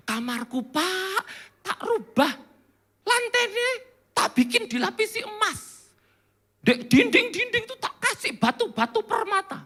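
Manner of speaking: high, put-on voice of a wealthy show-off; comic rich homeowner, rising high; boastful rich person. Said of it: "orang kaya di Semarang ini".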